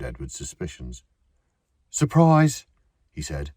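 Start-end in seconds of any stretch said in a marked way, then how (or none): 1.9-2.7 high, loud cheery Surprise; pitch perfect surprise. A narrator's reading. Edward faking cheer to catch Robert out, bright call. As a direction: sell a breezy surprise. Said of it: "the voice was pitch perfect".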